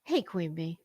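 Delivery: ordinary talk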